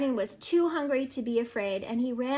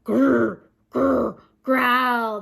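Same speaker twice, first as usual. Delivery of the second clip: loud rumbling growl; louder growl; 8 dB louder, much slower than usual. A narrator's reading. Growl closing in on dragon, loud and rumbling. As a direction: let the beast growl closer. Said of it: "The growling got louder and louder".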